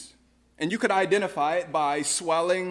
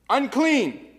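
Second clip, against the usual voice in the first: leper's cry; 7 semitones higher, 7 dB louder, slower than usual. Acted. Leper crying out at window.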